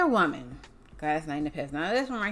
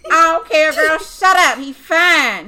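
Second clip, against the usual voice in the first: higher, much louder sassy retort; mock smitten girl; 8 semitones higher, 12 dB louder, slower than usual. Friend answering the song's warning, loud sassy mock retort. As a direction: snap back as a lovestruck fan. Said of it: "And I'm like".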